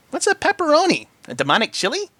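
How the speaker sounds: high, curious pony voice; puzzled Pinky. A narrator's reading. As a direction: ask innocently.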